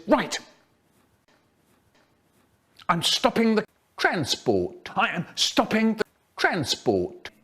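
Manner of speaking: high, thin teacher's announcement; weedy little voice. A narrator's reading. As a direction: put your foot down in a weedy voice. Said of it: "in a weedy little voice".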